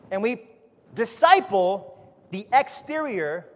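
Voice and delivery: loud, high preacher's cry, stretched out; a preacher building to a shout before his flock; full-force preaching. Acted. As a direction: drive it home to the congregation.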